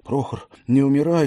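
plain reading voice

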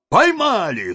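высоко и громко, с торжеством; выкрикнуть с порога о добыче; радостный вскрик. Acted. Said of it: "вскрикнули вошедшие". (десятские кричат голове, что поймали)

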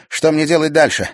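high, loud and fast; breathless urgent report; urgent young witch. A narrator's reading. (взволнованный доклад ведьмы)

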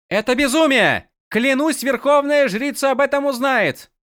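high, outraged threat; threaten, high and furious; outraged woman voice. Acted. Вин яростно протестует. (высокий возмущённый голос)

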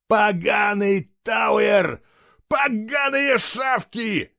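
slow, high-pitched screamed curses; enraged scream. A narrator's reading. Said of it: "закричал он, выплёскивая все накопившиеся эмоции". (яростный протяжный крик)

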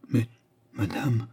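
halting, broken protest; broken murmur. A narrator's reading. Duke protesting to the widow, halting murmur. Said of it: "murmura le duc d'une voix entrecoupée".

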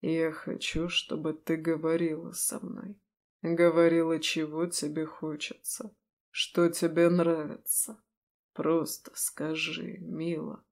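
a hint of a low seductive man; low, soft demon lover. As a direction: coax her, low and soft.

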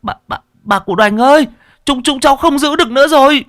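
loud, high-pitched stammering cry; panicked, strained yell; straining, at breaking point. Acted. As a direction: call for help under a load about to drop. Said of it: "dường như là chúng nó đã không chịu nổi".

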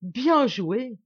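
loud, high, admiring cry; amused exclamation. A narrator's reading. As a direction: applaud the trick out loud. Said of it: "il éclata de rire et s'écria". (exclamation amusée et admirative)

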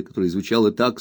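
ordinary delivery

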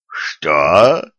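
the shocked executioner gasping at the accusation; gasped question. A narrator's reading. Stunned executioner gasps, caught out. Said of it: "ахнул палач".

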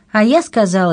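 insistent woman. Acted. Anna argues back at the king.

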